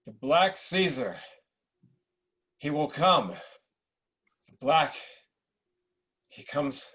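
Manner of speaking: slow, ominous delirium; prophesy in a fevered daze; understated delirious prophecy; raving dying emperor. Acted.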